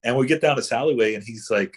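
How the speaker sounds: usual speaking voice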